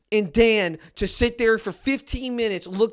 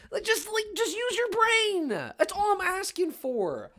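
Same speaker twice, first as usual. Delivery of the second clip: strained high plea; 10 semitones higher, 6 dB quieter than usual. Real, not acted. Exasperated plea.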